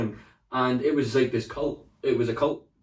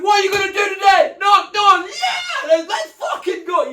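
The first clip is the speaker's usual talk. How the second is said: screaming pep rally, much higher and louder; shouty hype; 8 dB louder, slower than usual. Retold or imitated. Sales boss pumping up staff, loud shouted hype. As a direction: get everyone bumped up. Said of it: "he would do that shouty thing".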